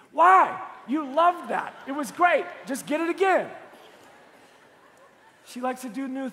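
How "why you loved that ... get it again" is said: loud, high-pitched comic exasperation; exasperated protest. Acted. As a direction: protest in loud, punchy bursts. Acted exasperated reaction to wife's order.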